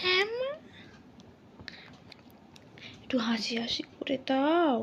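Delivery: fast soft whisper; whispered coaxing. Real, not acted. Mother whispering to coax her baby into smiling. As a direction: coax a smile in a hushed, quick whisper.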